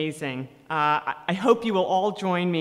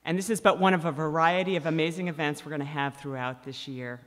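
ordinary talk, nothing marked

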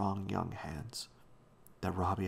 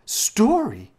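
high, very loud outburst; scandalized exclamation; 14 semitones higher, 14 dB louder than usual. A narrator's reading. Janet interrupting old Da's tale, scandalized exclaiming. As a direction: cry out in shocked disapproval. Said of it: "exclaimed Janet with a scandalized look".

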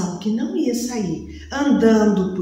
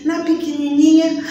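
plain reading voice

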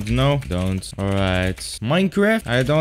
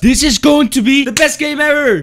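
mock hype; 13 semitones higher, 7 dB louder than usual. Hyping the game ironically.

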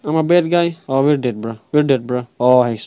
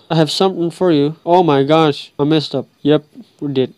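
ordinary talk, nothing marked

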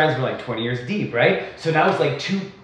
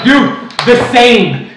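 mock shout; 6 semitones higher, 7 dB louder, much slower than usual. Yelling back at a rival.

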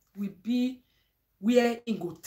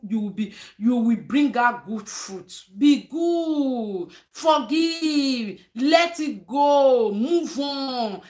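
louder, slow, emphatic exhortation; preaching; 3 semitones higher, 6 dB louder, slower than usual. Real, not acted. Vlogger exhorting her followers at year end, preaching. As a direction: preach it to the congregation.

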